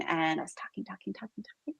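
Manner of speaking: words trail off into a breathy whisper; comic fade-out, as if the voice has been talked away; fading to a whisper. Pretend. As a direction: let the repeated word dwindle to nothing. Talking all morning, voice fading out.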